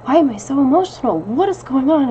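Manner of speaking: puzzled self-talk while crying over pets; bewildered inner voice. Retold or imitated. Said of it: "I'm sitting here thinking".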